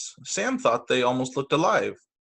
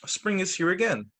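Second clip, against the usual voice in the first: plain reading voice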